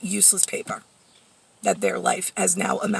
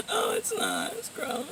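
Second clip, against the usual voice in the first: much higher, breezy mock-innocent; mocking dismissive voice; 13 semitones higher than usual. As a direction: parody people who shrug off lice. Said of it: "No, they're just like".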